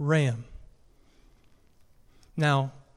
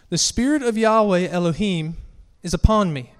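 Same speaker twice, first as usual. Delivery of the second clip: raised, proclaiming scripture voice; scripture reading; 4 semitones higher, 3 dB louder than usual. Acted. Preacher reading Isaiah to the congregation, proclaimed scripture reading. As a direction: read it out high and declaratory, phrase by phrase.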